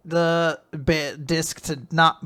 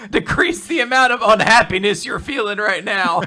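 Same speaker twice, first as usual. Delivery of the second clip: laughing mock quote; 7 semitones higher, 9 dB louder than usual. Quote delivered through laughter.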